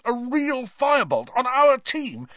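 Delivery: high, loud rising excitement; ecstatic. A narrator's reading. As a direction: rave about it with glee. Wood carried away with excitement.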